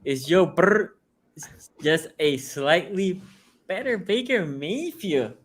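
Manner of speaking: slow, drawn-out sports pundit voice, pitch climbing; Cowherd impression. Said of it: "in my best cowherd voice".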